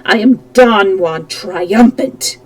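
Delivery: loud, slow roar of triumph; roared boast, stretching each word. Acted. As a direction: bellow it out, gloating. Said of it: "he roared".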